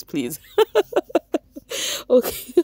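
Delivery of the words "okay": high bursts of laughter; trying to stop laughing; giggling. Real, not acted. Regaining composure for viewers, giggling. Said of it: "let me continue".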